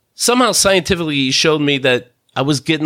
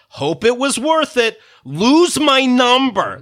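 slow bitter read of the text, "Lose my number" drawn out; deliberate sarcastic recital; mocking read; 6 semitones higher than usual. Reading a hurtful text aloud.

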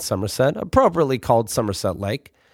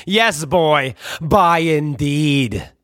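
pompous dad voice; much slower than usual. Retold or imitated. Dad has the last word.

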